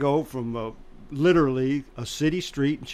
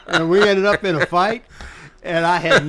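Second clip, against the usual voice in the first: real laughter running through the line, higher and louder; laughing through words; 4 semitones higher, 7 dB louder than usual. Real, not acted. Telling the guest how the fight started, still laughing.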